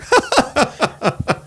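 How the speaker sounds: high laugh. Real, not acted. Laughing at his own daddy-one joke, high laugh.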